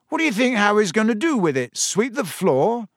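sarcastic retort. Acted. Ron scoffing at Hermione's caution, sarcastic. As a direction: rush the question, then stretch the punchline.

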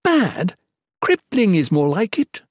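high-pitched indignant complaint; incredulous, high-voiced grumble; complaining. A narrator's reading. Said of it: "Erin complained to his plump companion".

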